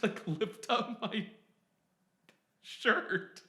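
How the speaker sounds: squirming with embarrassment as he says it; embarrassed laugh. Real, not acted.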